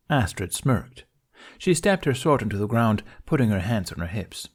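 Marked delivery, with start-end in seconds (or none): none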